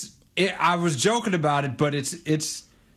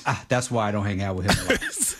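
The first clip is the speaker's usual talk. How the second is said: much lower, resigned aside to oneself; let-down realisation; 10 semitones lower than usual. Acted. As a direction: drop the pitch way down, acting mildly let down. Acted weary realisation about a homie.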